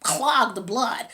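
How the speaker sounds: usual speaking voice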